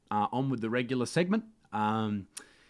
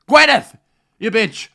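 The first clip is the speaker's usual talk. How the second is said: mock angry shout; 22 dB louder, slower than usual. Mock outburst at Gwyneth.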